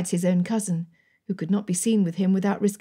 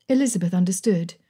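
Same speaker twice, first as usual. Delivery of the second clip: usual speaking voice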